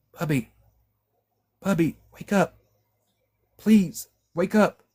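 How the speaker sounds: begging him to wake up; desperate plea. A narrator's reading. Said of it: "a desperate plea".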